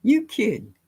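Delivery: high, slow Southern grandma scolding; playful grandma impression; imitating grandma. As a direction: mimic a country granny hushing a child. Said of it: "She'd be like".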